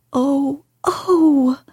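high delighted gasps; a boy thrilled by the news; cried out in delight. Acted. Colin hearing Dickon will come, high and delighted. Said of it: "Colin cried out in delight".

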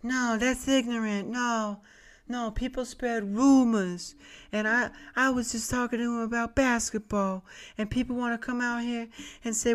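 high, soft, breathy-free falsetto pop-star voice. Retold or imitated. Salesman answering the angry mom in a high falsetto. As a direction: go high and gentle like the singer pleading innocence. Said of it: "went full [name]".

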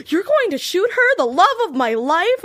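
high, loud, wounded disbelief; hammed-up anguished outcry; dramatic protest. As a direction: cry it out like a heartbroken hero. Retold dramatic Dean protest.

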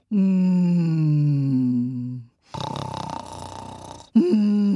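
loud, low humming snore; long noisy sleeper's snores; bear-like snoring. A narrator's reading. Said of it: "snoring like a bear".

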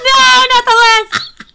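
high, loud, slow laughing cry; laughing protest. Real, not acted. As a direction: shriek it through laughter. Laughing protest about the lisp.

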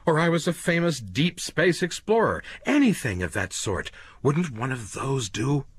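a cornered man talking fast to save himself; desperate Quayle. Acted. Quayle pleads his case.

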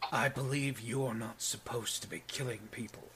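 low, quiet, matter-of-fact giant; a lightly voiced calm brute scolding; big guy's voice. Big guy looms over fallen Gideon, quiet low rebuke. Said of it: "and he goes".

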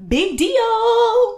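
high, drawn-out mock excitement; slow, soaring mock hype; sarcastic fanfare. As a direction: sing it out as if hugely impressed. Mocking the small prize.

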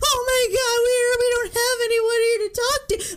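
a frantic high-pitched panic; panicked. Retold or imitated. Telling friend about panic when phone line died, high frightened cry. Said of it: "And I was like".

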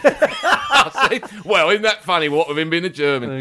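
slow, high, laughing through it; high giggling quip. Retold or imitated. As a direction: barely get the words out for laughing. Laughing, quoting own quip.

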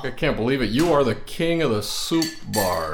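loud, high cheering; excited. Real, not acted. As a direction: hail the champion. Cheering the soup feat.

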